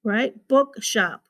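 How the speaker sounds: normal talking voice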